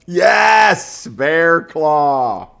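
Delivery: loud, high burst of triumph; thrilled confirmation; excited yes. Real, not acted. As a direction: cheer the right answer. Guess finally right, excited.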